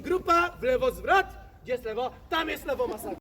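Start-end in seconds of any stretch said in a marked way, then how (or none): 0.0-1.3 drill sergeant. Acted. Drill commands to tourists.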